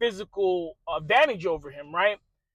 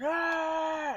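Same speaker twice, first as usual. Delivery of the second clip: high shouted crowd cheer "Ah!"; mock crowd roar; 8 semitones higher, 7 dB quieter than usual. Narrator imitates soldiers cheering for their champion, high roar. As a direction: imitate an army roaring.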